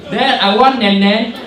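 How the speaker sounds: put-on high, loud babyish begging; whiny child voice. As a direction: beg like a small child. Child asking dad for milk.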